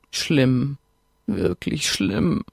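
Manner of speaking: sepulchral voice. Acted. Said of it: "sagte sie mit Grabesstimme".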